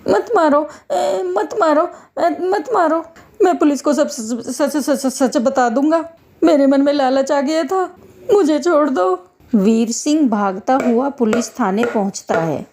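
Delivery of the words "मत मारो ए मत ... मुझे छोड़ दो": high, whimpering begging; frightened pleading. Acted. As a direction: stammer and beg for mercy under blows. Begs while being beaten.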